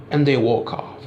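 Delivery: normal talking voice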